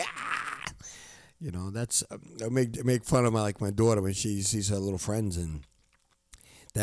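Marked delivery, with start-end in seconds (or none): 0.0-0.6 breathless voiceless squeal of joy; excited squeal. Retold or imitated. Imitating giggly friends meeting again, a voiceless excited squeal. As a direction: let out a whispered giddy squeal. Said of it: "you're like".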